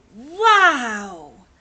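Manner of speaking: loud, awestruck exclamation; amazed wow. Acted. Wilson peeking into the window, amazed. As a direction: burst out in wonder.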